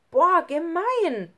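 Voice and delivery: loud, high, drawn-out outrage; indignant outburst. A narrator's reading. Lukas discovers the prank note, indignant. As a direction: cry out at the unfairness, slowly.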